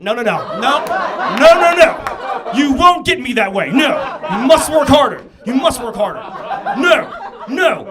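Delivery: high, shouted comic refusal; loud mock refusal. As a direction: shout it down in exaggerated alarm. Acted refusal of crying cop's gesture.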